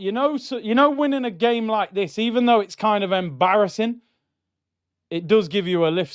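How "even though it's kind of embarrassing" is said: ordinary delivery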